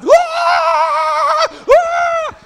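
very high, loud mock scream; hammed-up terrified shriek of a big man. Friend hit by rubber snake runs off, loud high scream. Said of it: "he was just screaming the whole time".

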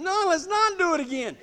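high-pitched pleading refusal; protesting. Retold or imitated. Scared rider answering his eager partner, high protest.

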